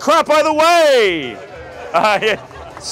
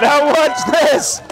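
theatrical magician; 3 semitones higher, much slower than usual. Acted. Magician's showman cue.

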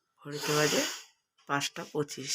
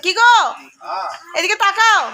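calling out; 10 semitones higher, 5 dB louder than usual. Real, not acted. Calling husband to look at camera.

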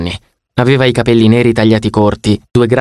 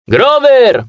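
high, loud counter call; waiter shouting; 13 semitones higher, 3 dB louder than usual. Acted. The waiter shouting Grover's name from the counter, high and loud. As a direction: call the name across a busy shop. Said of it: "urlò il cameriere".

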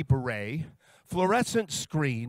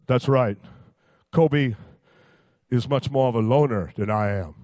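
lower, louder big-man voice; Shaq voice; 5 semitones lower, 6 dB louder than usual. Retold or imitated. Shaq telling Roy how upset he is, in a low booming voice. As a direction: put on a deep, booming Shaq.

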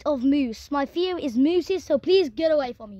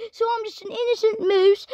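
higher-pitched protesting moose; innocent moose; 5 semitones higher than usual. Pretend. Moose defending himself to the sheriff, protesting innocence. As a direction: plead innocence in a raised voice.

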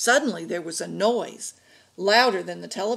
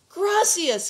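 high, loud cry of recognition; yell; 11 semitones higher, 5 dB louder than usual. A narrator's reading. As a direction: yell the name on a reunion. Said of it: "yelled Miguel".

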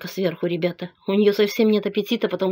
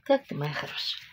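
softer, tender comfort to a dog; ласковый голос собаке; 4 semitones lower, 5 dB quieter than usual. Real, not acted. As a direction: soothe a frightened dog gently. (тихо и нежно, утешая собаку)

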